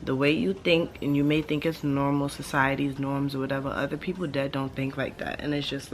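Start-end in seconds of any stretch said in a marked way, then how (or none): none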